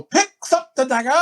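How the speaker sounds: high theatrical movie quote; over-the-top. Retold or imitated. Someone spots a knife on the table, over the top. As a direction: ham up the dramatic discovery. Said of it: "it's just always over the top if you do it".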